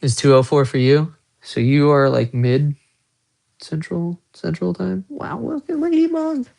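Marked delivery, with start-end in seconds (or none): 5.1-6.5 cutesy high voice. Pretend. Spots a bug on window.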